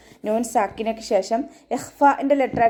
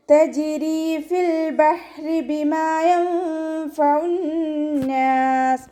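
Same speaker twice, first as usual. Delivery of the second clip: slow, high melodic tajweed chant; chanted Quranic verse, drawn out; Quran recitation; 8 semitones higher, 3 dB louder, much slower than usual. Shown as a demonstration. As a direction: stretch each syllable in a ringing recitation.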